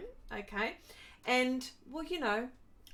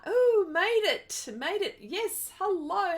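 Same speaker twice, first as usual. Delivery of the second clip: delighted; 9 semitones higher, 8 dB louder, slower than usual. Real, not acted. Viewer arrives, delighted greeting.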